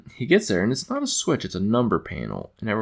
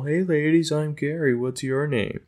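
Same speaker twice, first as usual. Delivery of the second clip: higher-pitched retold greeting; lightly put-on voice greeting the ladies; a hint of an acted come-on, pitched up; Gary hailing ladies; 7 semitones higher than usual. Gary greets ladies while flying past.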